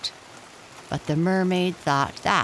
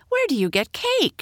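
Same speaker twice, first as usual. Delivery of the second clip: excited question; 12 semitones higher, 4 dB louder than usual. Acted. Hilda amazed by cake.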